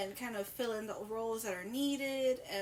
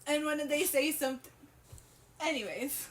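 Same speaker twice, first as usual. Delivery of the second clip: ordinary delivery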